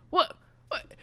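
high, fast, frantic pleading about her cats; breathless high-pitched cat lady begging not to go; panicky cat lady. Acted. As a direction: squeal it out fast, a cat lady being abducted. Rhonda pleading as she's beamed up.

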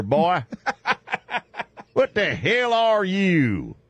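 hillbilly yokel. Pretend. Hillbilly mocking Dog's look.

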